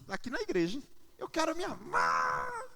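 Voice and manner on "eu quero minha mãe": imitação aguda e arrastada da filha; filha chorosa. Retold or imitated. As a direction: imite uma menininha pedindo pela mãe, esticando a última palavra. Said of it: "ela lembra que a mãe dela não tá mais". (voz de criança manhosa)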